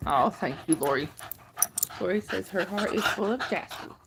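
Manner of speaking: ordinary delivery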